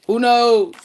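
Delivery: loud, high-pitched "who knows?"; high call-out. Real, not acted. Musing to viewers about popping out with someone new, high loud call-out. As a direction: belt the question out high. Repeats the question, calling it out.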